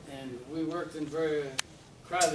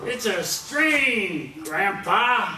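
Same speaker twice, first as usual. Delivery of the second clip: slow, high, loud calling to a deaf grandfather; loud drawn-out yell to hard-of-hearing grandpa; shouting to deaf grandpa; 6 semitones higher, 11 dB louder, much slower than usual. Acted. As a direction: project it so grandpa can hear. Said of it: "talk to my grandfather".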